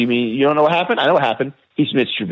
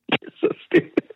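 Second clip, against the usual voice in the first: breathy hiss of an insult; exasperated whispered jab; 4 dB quieter than usual. Real, not acted. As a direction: whisper it with disgust. Heated fantasy football argument.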